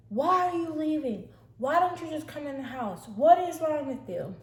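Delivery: high, loud exasperated yell; retold yelling. Sister yelling at Rebecca for leaving, high retold yell. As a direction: shout accusing questions in a high voice. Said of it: "she's yelling at her like".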